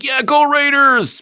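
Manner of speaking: a hammed-up fan shouting for the Raiders; mock sports fan cheer. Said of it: "sports fans can't be like".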